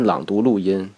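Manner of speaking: ordinary delivery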